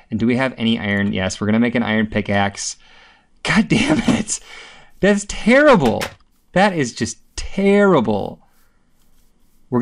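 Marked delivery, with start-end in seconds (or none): 3.4-8.4 much higher, drawn-out frustration; exasperated outburst. Real, not acted. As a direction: wail it out at the bad luck. Dismay at lost items.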